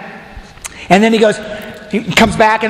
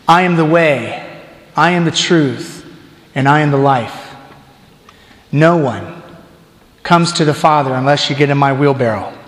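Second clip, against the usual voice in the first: paraphrased Jesus; 7 semitones lower than usual. Retold or imitated. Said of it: "Slightly paraphrased by the Hobbit Deacon".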